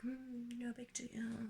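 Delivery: breathy casual whisper; whispered cool. Retold or imitated. Acting cool in convertible.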